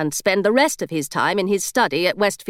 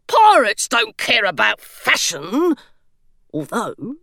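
slow, dismissive stone gargoyle; slower than usual. A narrator's reading. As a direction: brush off fashion like a proud gargoyle.

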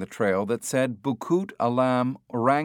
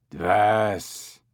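breathy coughed-out ape word; cough-like ape sound. A narrator's reading. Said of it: "like a cough".

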